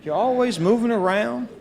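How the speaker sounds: a hint of a worried grandmother's North Carolina accent, rising; fond imitation of a fretting North Carolina grandma, voice lifting; imitating grandma's twang. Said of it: "In her North Carolina twang, she would say with pain in her voice".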